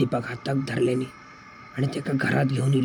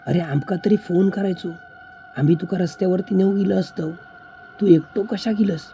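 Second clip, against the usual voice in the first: higher-pitched, surprised questioning; astonished; 6 semitones higher, 3 dB louder than usual. Acted. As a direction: ask in growing amazement, pitch climbing. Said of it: "आश्चर्यचकित होऊन म्हणाले". (आश्चर्यचकित होऊन विचारणे)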